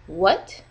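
"what" swept up from low to high; rising tone. Shown as a demonstration. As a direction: lift "what" sharply like a question. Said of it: "It goes from low to high".

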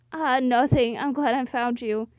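ordinary talk

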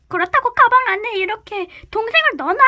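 high, fast, outraged scolding; indignant scolding. Acted. Cat scolds sister for stuffing rabbits in a bag. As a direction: rattle it off in disbelief.

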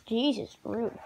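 ordinary talk, nothing marked

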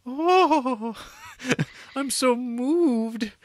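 very high, slow mock swoon with a laugh; swooning [name]. [name] after his stunt, mock swooning. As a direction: swoon over him, hammed-up. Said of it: "of course, like".

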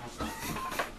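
very high airy giggle; high breathless squeal of laughter; squeaky laugh. Real, not acted. Laughing at own joke.

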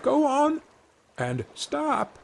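high calls to the horse; little boy calling commands to a horse; tiny Tom calling. A narrator's reading. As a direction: sing out commands from the horse's ear. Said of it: "crying out".

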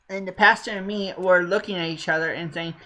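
ordinary delivery

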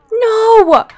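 loud high cry of no; anguished shout. Real, not acted. Player crying out as Tobias loses. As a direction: wail it out.

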